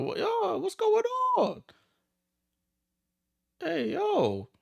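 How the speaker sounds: what on earth just happened; shrieking disbelief at the court; stunned disbelief. Real, not acted. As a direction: voice jumps way up in shock. Stunned by a wild slide tackle.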